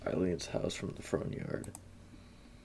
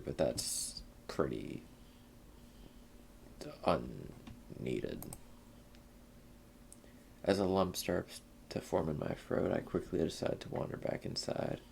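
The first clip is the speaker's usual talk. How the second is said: ordinary talk, nothing marked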